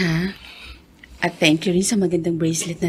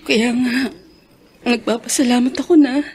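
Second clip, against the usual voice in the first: tearful joy; 4 semitones higher, 5 dB louder than usual. Acted. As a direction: voice rising, close to tears. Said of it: "gusto mo pang umiyak". (naiiyak sa tuwa ang asawa)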